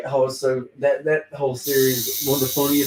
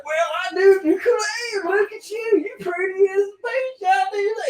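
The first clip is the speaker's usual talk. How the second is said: hammed-up Texan; 3 dB louder than usual. [name] as rich tycoon greeting a lady, hammy Texan accent. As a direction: lay on a fake Texan accent thick. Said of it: "really hamming it up".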